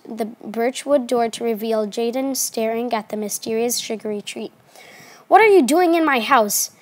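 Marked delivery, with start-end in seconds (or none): 5.3-6.7 high-pitched, indignant story shout; restrained shout from a startled character. A narrator's reading. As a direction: lift the pitch as if shouting, without full volume. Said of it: "Jaden shouted".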